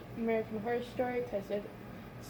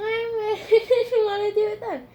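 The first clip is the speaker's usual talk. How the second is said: laughing; 11 semitones higher, 10 dB louder than usual. Real, not acted. Laughing while retelling fear.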